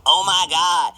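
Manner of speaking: hammed-up sassy drama, mildly. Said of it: "so I gotta put on my sassy voice".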